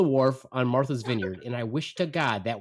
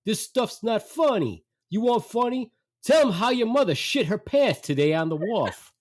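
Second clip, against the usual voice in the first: loud heckling dad; 11 semitones higher, 4 dB louder than usual. Retold or imitated. Dad heckling son's act before the audience, loud. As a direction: imitate a father heckling for all to hear. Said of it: "loud enough for the whole audience to hear".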